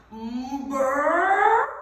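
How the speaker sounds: huge, loud imitated belch, rising; roaring burp. A narrator's reading. Woman by the rubbish bags burping, enormous roar. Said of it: "an enormous roar escaped the woman's mouth".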